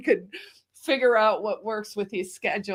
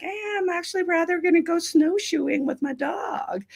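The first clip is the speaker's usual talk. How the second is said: mock lazy excuse; 8 semitones higher than usual. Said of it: "But you're like".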